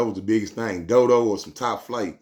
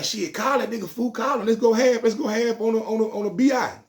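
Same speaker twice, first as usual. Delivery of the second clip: higher, eager urging; voicing his partner; 12 semitones higher than usual. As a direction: push him to call, pitched up. Partner Dead voiced urging.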